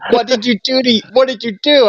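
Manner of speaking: high, hammed-up mock alarm; mock alarmed friend. Tattoo artist demanding what happened, hammed-up high alarm. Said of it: "Or he's just like".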